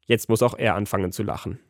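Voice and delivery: plain reading voice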